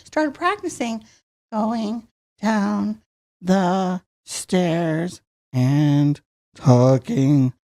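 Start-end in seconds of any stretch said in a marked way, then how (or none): 1.5-7.5 walk the voice down the stairs; much lower voice. Shown as a demonstration. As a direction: voice dropping much lower, step by step. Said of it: "that's how I discovered to talk low".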